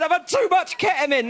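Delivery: high, loud panicky wail; panicked raver. Retold or imitated. Drugged bloke in the next tent, panicked shout. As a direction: yell like you've overdone it. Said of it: "this bloke was saying".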